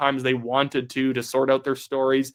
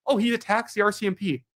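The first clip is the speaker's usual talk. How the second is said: higher-pitched parroting of an accuser; mock accusation; 7 semitones higher than usual. Host parroting a critic about the police, mock accusation. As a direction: mimic a critic's complaint, pitched up.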